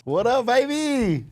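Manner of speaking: high, slow, stretched-out hype hello; drawn-out excited shout-out; hype greeting. Real, not acted. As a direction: greet him like he just walked on stage.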